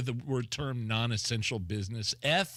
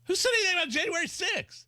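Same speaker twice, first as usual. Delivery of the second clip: incredulous outburst; faster than usual. Real, not acted. Co-host baffled by the guest, a high incredulous cry. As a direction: throw up your hands: who said that?.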